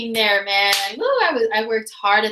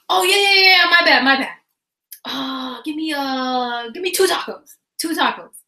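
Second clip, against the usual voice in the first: bum voice; 2 semitones higher than usual. Retold or imitated. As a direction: play the bum caught out, eager to fix it. Bum corrects his order.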